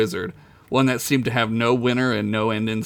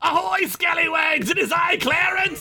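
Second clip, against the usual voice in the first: tiny clam pirate shout. A narrator's reading. Tiny clam pirate hails them from the docks, squeaky shout. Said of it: "the tiny clam pirate suddenly shouted".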